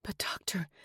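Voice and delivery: urgent voiceless whisper. A narrator's reading. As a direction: whisper the plea, worried. Chelsea pleads quietly.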